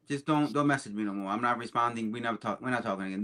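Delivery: low, quiet, final dismissal; stern brush-off. Pretend. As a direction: cut them off in a low, subdued voice. Imagined stern brush-off over texting.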